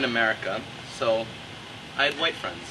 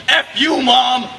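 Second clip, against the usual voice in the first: put-on louder, higher teen yell at mom; a bratty kid snapping at his mother; mock angry yell; 10 semitones higher, 10 dB louder, much slower than usual. [name] to his mom about cleaning his room, in a mock angry yell.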